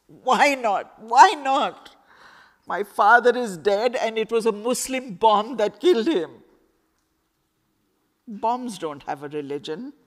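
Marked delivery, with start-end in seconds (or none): 0.1-6.4 louder and higher, demanding; furious grieving shout. Acted. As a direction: hurl the question at him in pain. Girl shouts her grief at Khalid.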